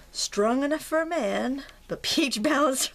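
hammed-up deodorant commercial line; commercial slogan. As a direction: sell the slogan like an old TV ad. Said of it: "What was that commercial?".

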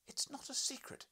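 high, indignant cry; cried out. Acted. Wife to husband, a high indignant cry. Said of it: "she cried".

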